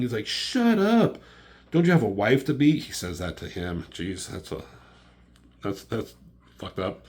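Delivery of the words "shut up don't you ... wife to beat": retold angry yell, high and loud. Iron Man yells at the one questioning him, angry shout. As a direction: act out snapping back at the top of an argument. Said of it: "he's just yelling".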